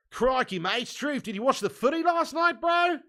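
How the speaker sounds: loud, high-pitched Aussie caricature, rising to the end; mock Australian accent. Streamer teasing Aussies about footy, hammed-up Australian accent. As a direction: ham up an Australian asking his mate.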